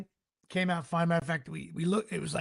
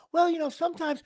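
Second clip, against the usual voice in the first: lightly voiced nurse, higher pitched; hint of a dismissive nurse, pitched up; mock nurse; 9 semitones higher than usual. Teller mimicking the ER nurse brushing off his symptoms, pitched up.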